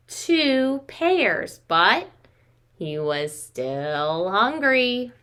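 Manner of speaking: lively storybook reading with slowed refrains; reading to children. Acted. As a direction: read it to little ones, drawing out each day. Reads picture book to children.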